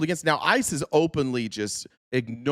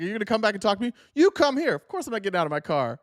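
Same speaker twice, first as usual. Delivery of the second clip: mock officer voice; 10 semitones higher, faster than usual. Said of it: "And he's like".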